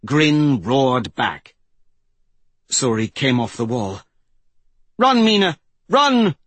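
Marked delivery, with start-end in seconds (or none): 5.0-6.3 high, loud, urgent warning cry; urgent shout. Acted. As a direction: yell it desperately to make a friend flee. Suri urges wolf to flee bear.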